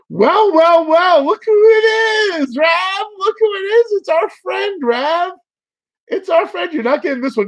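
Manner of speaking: high, loud, hammy gloating; mock villain greeting. Greeting a sought card mockingly.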